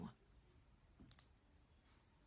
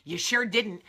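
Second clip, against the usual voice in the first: louder, higher sassy kiss-off; sassy dismissal; 3 semitones higher, 6 dB louder than usual. Retold or imitated. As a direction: tell him off and walk out, done with him. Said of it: "And you will look at him and go".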